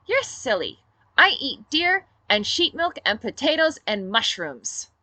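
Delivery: put-on a giggly small girl explaining her food; little girl.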